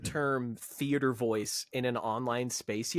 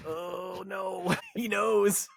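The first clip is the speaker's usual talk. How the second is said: higher, drawn-out mock dread; 6 semitones higher, much slower than usual. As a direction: play it as a secret being exposed. Voices his degree's dread, being found out.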